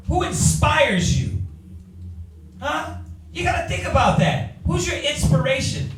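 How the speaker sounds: rising pastoral challenge; challenging question. Acted. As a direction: push the question at the listeners. Preacher challenging congregation.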